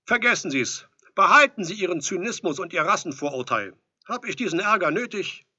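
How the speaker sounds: loud, high, offended rant; indignant outburst. A narrator's reading. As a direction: call it out as if fed up. Visitor gives up, annoyed.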